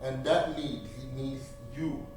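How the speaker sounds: ordinary delivery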